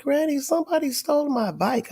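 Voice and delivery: put-on high, whiny little boy's voice; upset grandson.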